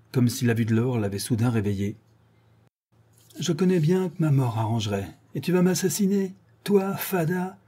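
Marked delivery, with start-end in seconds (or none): 3.4-7.6 high-pitched, scornful disbelief; raised pitch, defying a threat; indignant exclamation. Acted. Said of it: "s'exclama le père".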